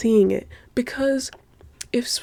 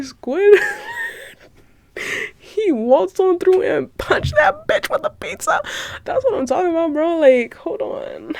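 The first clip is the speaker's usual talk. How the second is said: ecstatic; 10 semitones higher than usual. Real, not acted. Fan cheering Squidward's pizza punch, high shrieking delight. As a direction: squeal with delight, barely holding it together. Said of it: "ecstatic rant".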